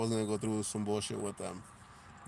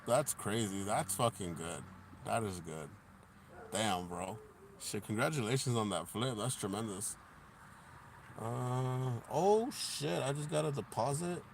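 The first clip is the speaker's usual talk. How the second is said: ordinary talk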